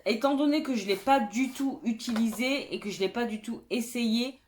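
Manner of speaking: ordinary delivery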